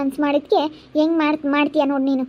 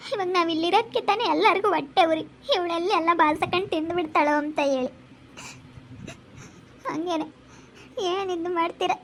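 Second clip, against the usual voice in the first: tearful; 2 semitones higher, 6 dB quieter than usual. Acted. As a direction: speak through tears, hurt and quiet. Hurt daughter-in-law weeps, leaving. (ಅಳುತ್ತಾ ಮೆಲ್ಲಗೆ ಮಾತನಾಡುವುದು)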